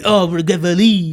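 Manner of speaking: high, slow mock-French apology; French accent impression. French reporter backing out of his promise, French accent. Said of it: "I could do an impression".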